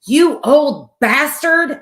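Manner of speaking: hammed-up road-rage shout; imitating an angry yell. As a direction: bellow it like a mad old driver at traffic. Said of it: "he was so freaking mad".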